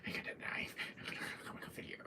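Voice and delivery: soft, breathy whisper threat; creepy whispered menace; menacing wife. Retold or imitated. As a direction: whisper it like a stalking killer. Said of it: "quote of Mia".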